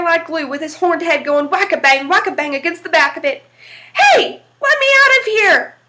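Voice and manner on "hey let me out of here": high loud holler, much higher. Acted. Little devil stuck in the rocker, hollering high and loud. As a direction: yell to be let out of the chair. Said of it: "he hollered".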